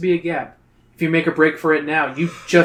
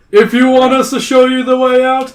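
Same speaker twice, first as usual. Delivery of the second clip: loud, high royal declamation; bellowing; 9 semitones higher, 9 dB louder than usual. A narrator's reading. King sets Zoe a condition, loud bellow. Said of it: "gazes into Zoe's face and bellows".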